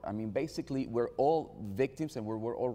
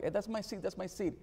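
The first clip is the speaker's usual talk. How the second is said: imitating a little girl, much higher, insistent; stronger child protest; 9 semitones higher than usual. Niece protests harder to the fifteen-year-old, higher insistent child voice. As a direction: pitch it up like a kid demanding her seat back. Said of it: "protest a little bit stronger".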